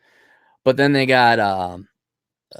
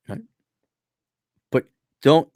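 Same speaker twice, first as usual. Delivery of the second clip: ordinary talk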